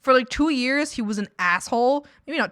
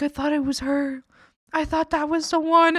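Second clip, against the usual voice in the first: mock heartbroken whine; 6 semitones higher, 3 dB louder than usual. Imitating sad brother after breakup.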